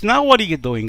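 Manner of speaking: loud, high fed-up question; wife challenging her husband; exasperated demand. Acted. Wife demanding in acted quarrel.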